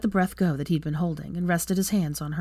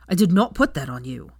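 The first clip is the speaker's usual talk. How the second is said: louder, stress on "not" and "put"; shouted denial; 4 dB louder than usual. Acted. Draco shouting his denial at Hermione, loud. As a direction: hammer "did not put" home. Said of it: "shouted back at her".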